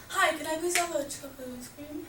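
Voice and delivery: small, slightly high put-on voice; softly mimicked silly customer; soft prank order. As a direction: mumble a drive-through order as a joke. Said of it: "please, like, speak up or leave".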